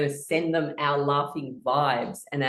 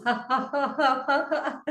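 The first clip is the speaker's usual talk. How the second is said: rhythmic ha ha laughing; laughter yoga laughing; 7 semitones higher than usual. Shown as a demonstration. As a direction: chant ha ha while shaking. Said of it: "let it go down through the arms".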